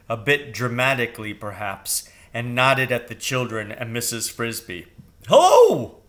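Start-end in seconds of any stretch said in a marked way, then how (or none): 5.3-6.0 very high, loud bird squawk of a greeting; squawky high crow hello. A narrator's reading. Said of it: "he said".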